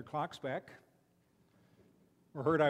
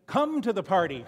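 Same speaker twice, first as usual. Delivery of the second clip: louder invitation; 3 semitones higher, 9 dB louder than usual. Retold or imitated. Granddaughter calling strangers in from the restaurant door, louder. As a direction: Come to the party called out louder, leaning on Come. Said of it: "now she's saying".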